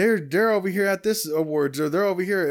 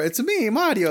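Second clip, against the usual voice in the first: put-on Italian accent; 6 semitones higher than usual. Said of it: "stereotypical Italian accent".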